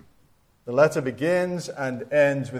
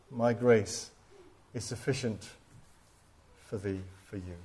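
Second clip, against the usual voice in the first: hushed; 4 semitones lower, 7 dB quieter than usual. Real, not acted. Hushed close before prayer.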